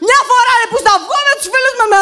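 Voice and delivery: hammed-up furious partner yelling at her; angry partner. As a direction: play the shouting boyfriend, rushing the words. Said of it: "θα πάει κάπως έτσι".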